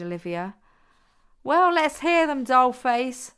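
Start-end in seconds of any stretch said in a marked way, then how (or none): 1.4-3.3 loud, high teasing call. A narrator's reading. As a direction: tease him, pitched up. Amelia teasing the boy.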